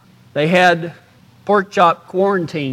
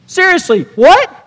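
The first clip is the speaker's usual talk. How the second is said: much higher, incredulous; mock disbelief; 9 semitones higher, 4 dB louder than usual. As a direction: act stunned at the answer.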